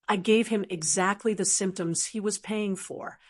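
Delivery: plain reading voice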